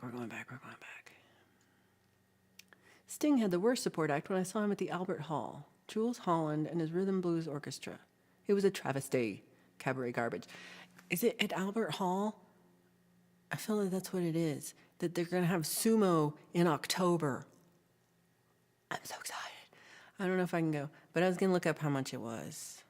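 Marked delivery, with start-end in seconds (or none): 0.0-1.1 fading whispered chant. Shown as a demonstration. Streamer whispering as she scrolls back through chat. 18.9-19.4 excited hushed whisper. Real, not acted. Streamer whispering excitedly about sumo tickets.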